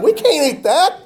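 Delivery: high, fast mock panic over the menu; hammed-up horror at pork on the picnic table; mock panicked outcry.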